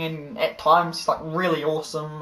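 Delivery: usual speaking voice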